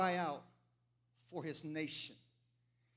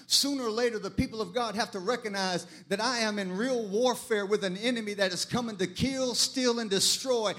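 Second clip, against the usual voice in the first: driving sermon building to a loud, raised cry; full-force preaching; 3 semitones higher, 7 dB louder than usual. Acted. Preacher rallying the church against compromise, full-force preaching. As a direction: preach with mounting urgency to a shouted peak.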